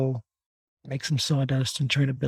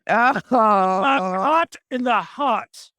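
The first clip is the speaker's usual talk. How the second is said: hammed-up British accent; 6 semitones higher, 5 dB louder, much slower than usual. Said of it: "in a British accent".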